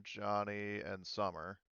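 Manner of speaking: usual speaking voice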